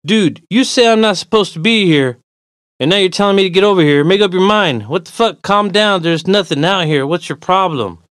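loud, high, rapid-fire protest; exasperated. Acted. Walker tells stranger to make up his mind, exasperated. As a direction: argue back at full tilt.

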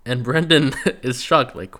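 normal talking voice

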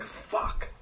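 whispered under the breath; a thought breathed out as a whisper; quiet inner swear; whispered curse. Retold or imitated. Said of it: "thinking, like".

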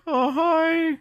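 put-on high, timid little boy voice; shy kid voice. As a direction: play a sheepish ten-year-old saying hi. Sheepish ten-year-old boy quoted.

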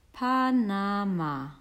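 "Panama" stretched slowly, each syllable held; sounding out. Acted. Correcting a friend at the signpost, sounding out "Panama" slowly. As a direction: spell out "Pa-na-ma" slowly as if deciphering it.

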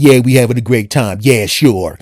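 loud, sulky mock complaining; passive aggressive whine. Him mocking his own sulky answer, loud and whiny. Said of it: "I'm like".

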